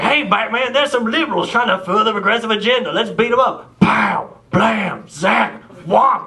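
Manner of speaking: high, fast campy cartoon hero voice; Robin-style sidekick to Batman, campy and high; sixties Batman sidekick. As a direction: ham up an old TV superhero. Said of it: "noises from the sixties Batman show".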